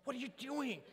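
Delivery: high squeaky mock alarm; mock exasperation. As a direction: hiss it at a friend gone silent. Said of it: "And I was like".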